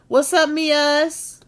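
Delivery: slow, loud, high greeting; drawn-out shout-out to a chatter; calling out. Real, not acted. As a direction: stretch the hello out loud.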